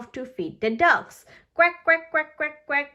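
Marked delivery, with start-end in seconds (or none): 1.5-2.9 shouted quacks. Acted. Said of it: "shouts Richard".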